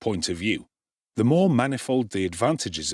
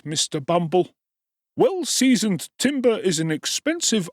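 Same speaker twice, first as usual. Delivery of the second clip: undertaker's voice; 9 semitones higher, 3 dB louder than usual. A narrator's reading. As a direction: plead your trade's costs in a high oily voice.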